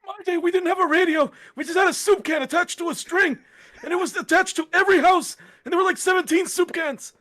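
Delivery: put-on high, creaky old man rambling; high quavering old-timer voice. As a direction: ramble like a doddering grandpa. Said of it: "the one old man voice".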